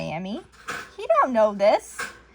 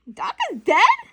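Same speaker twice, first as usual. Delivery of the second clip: shocked; 10 semitones higher, 5 dB louder, slower than usual. Retold or imitated. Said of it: "'Cause he's thinking, like".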